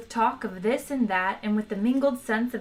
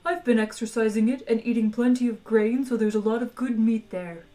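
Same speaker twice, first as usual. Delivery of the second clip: the cheerful Dish of the Day selling its own body; talking meat animal; 2 semitones higher than usual. Acted. Eager talking cow offering itself to diners, polite creature voice.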